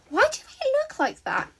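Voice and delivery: put-on curious high girlish voice; Himiko's voice. A narrator's reading. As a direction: pitch way up for the playful girl.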